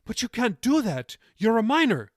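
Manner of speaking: high, loud panicked protest. A narrator's reading. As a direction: plead in alarm. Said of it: "she protested".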